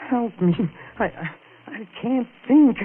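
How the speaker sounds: rising panic. Acted. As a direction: voice climbing into panic. Panicked plea after confessing murder.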